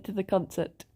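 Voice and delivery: ordinary talk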